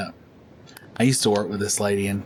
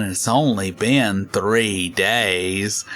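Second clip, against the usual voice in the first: very slow, dragged-out complaint; odd slow caller; much slower than usual. Retold or imitated. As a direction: stretch every word like it's urgent. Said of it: "do crazy sounding stuff".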